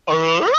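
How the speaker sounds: short questioning game-character noise; Banjo. Acted. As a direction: blurt a silly, high grunt. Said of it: "do my impression of Banjo".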